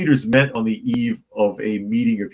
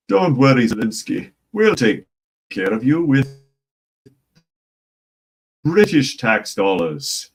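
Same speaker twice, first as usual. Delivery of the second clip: mock British reassurance; 4 semitones higher, 4 dB louder than usual. Mocking Starmer's imagined reassurance.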